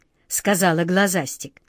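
ordinary delivery, nothing marked

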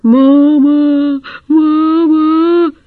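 put-on little boy roaring Мама! for his mother; trumpeting child's roar. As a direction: bellow for mum like a wailing kid. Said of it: "трубный рёв".

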